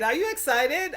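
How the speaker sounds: ordinary delivery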